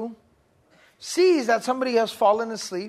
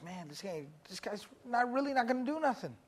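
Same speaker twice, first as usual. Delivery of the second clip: muttered, much softer; hushed grumble; 10 dB quieter than usual. Retold or imitated. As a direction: grumble to yourself at the desk. Classmate muttering.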